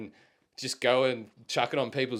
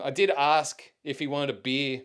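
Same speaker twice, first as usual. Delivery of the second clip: ordinary delivery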